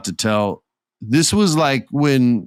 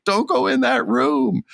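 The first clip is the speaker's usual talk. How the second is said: much higher, yelping warning at the screen; mock horror-movie scream. Viewer yelling at a horror movie character, high mock warning. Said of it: "when you would scream at the television".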